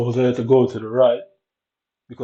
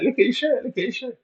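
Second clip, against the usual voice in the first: imitating a friend's high, excited taunt; schoolboy taunt mimicked in a much higher voice; mocking mate; 12 semitones higher than usual. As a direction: pitch way up, teasing like the schoolboys did. Mate pointing out tucked shirt.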